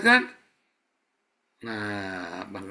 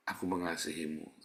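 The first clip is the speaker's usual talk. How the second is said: tender; 8 semitones lower, 7 dB quieter than usual. Pretend. Voices forgiving spouse.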